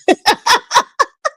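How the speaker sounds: helpless high laugh; high burst of laughter. Real, not acted. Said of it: "I'm sorry I'm laughing".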